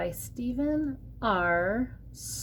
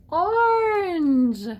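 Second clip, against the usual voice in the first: gasped, high and loud; gasp, then reveal the answer; excited reveal; 10 semitones higher, 6 dB louder than usual. Acted. Gasped answer to own question.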